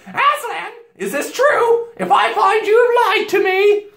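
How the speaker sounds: high, very loud threatening queen; shrieked imperious alarm; witch crying out. A narrator's reading. Queen threatening the boy over news of Aslan, crying out. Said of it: "cried the Queen".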